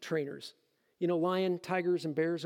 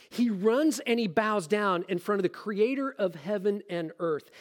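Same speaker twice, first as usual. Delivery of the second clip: ordinary talk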